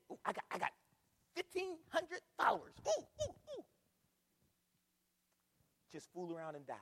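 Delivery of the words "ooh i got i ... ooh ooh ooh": high, excited squeal of a show-off; mock giddy bragging. Preacher mocking followers on Twitter, giddy high-pitched bragging. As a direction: gush in little high bursts, mocking. Mocks people thrilled by follower counts.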